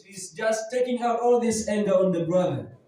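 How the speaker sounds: ordinary delivery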